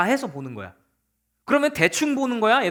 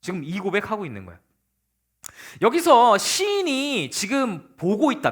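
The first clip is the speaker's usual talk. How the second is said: usual speaking voice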